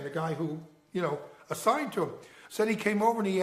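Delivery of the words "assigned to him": ordinary delivery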